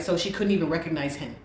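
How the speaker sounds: usual speaking voice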